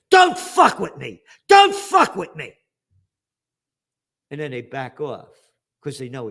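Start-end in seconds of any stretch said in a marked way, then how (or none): 0.1-2.5 building to a full shout, much higher; warning shout. Retold or imitated. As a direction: bark it at the bully, rising to a yell. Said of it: "you gotta punch above your weight".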